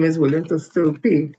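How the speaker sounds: ordinary talk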